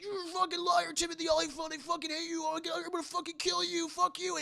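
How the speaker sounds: high hammed-up furious yelling; mock furious. Jerry yelling at Timothy Olyphant, high mock fury. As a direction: spit hate at him, high and fast. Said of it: "Jerry's like".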